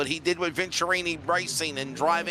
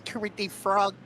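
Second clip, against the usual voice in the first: light frog puppet voice; a hint of Kermit; 4 semitones higher than usual. Shown as a demonstration. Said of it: "give it your best Kermit the Frog voice".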